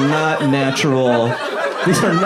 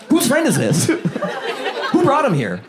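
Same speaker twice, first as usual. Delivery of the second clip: mock outrage; 10 semitones higher, slower than usual. Outraged table asks about guest.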